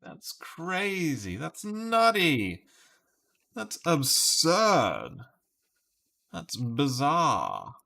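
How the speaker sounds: high, slow, drawn-out mock exclamation; exaggerated exclaiming. Player tries out guesses as reactions, exaggerated exclaiming. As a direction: stretch each reaction out with a big pitch swoop.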